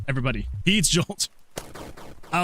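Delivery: ordinary talk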